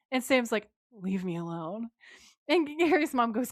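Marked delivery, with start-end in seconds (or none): 0.9-1.9 low, softer mock teenage sulk; sulky teenage anger. Sam snapping back at Gary's mom, sulky teenage grumble. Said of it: "most teenage way".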